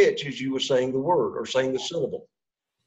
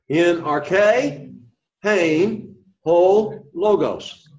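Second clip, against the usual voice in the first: higher, measured Greek read-aloud with separated words; call-and-repeat reading; 3 semitones higher, much slower than usual. Shown as a demonstration. Teacher has everybody say it together, call-and-repeat reading. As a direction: lead the class word by word. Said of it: "So everybody say it together".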